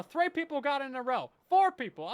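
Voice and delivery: loud, high tallying of the answers; excited call-out. Real, not acted. Streamer tallying chat's correct answers, loud and excited. Chat supplies the forgotten word.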